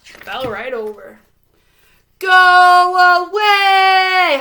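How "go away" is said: loud, high shout. A narrator's reading. Holler shouting at the tornado, loud shout. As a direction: drive the storm off with your voice. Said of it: "Holler shouted at the tornado".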